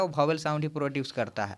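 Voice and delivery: ordinary talk, nothing marked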